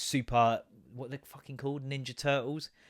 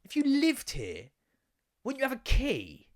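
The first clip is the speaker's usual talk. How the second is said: incredulous; 9 semitones higher, slower than usual. Retold or imitated. Incredulous thoughts about the stranger.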